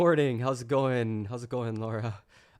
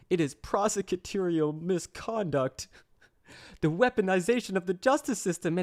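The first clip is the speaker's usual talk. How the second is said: petulant sobbing voice; 5 semitones higher than usual. Pretend. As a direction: whine it like a spoiled kid treated with disrespect. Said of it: "in a petulant voice, like he's sobbing".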